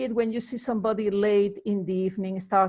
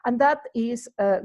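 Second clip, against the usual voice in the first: ordinary delivery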